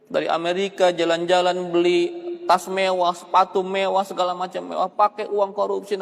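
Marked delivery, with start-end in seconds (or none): none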